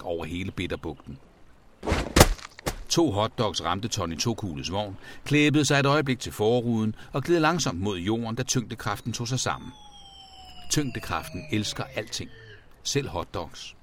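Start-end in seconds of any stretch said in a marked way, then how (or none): none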